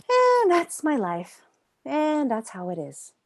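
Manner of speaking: high, loud and slow, a hammed-up resigned moan; mock defeated whine.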